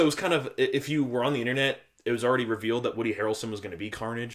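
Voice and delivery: ordinary delivery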